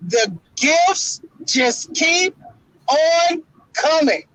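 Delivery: slow, high, savoring each word; drawn-out tease. Real, not acted. Bragging to his co-host, slow and high. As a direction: stretch it out like a gloat.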